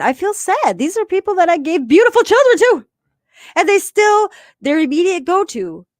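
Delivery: high, loud emotional outburst; impassioned hurt. Retold or imitated. Woman venting about people she gave children to, impassioned. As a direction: burst out with the hurt, voice soaring.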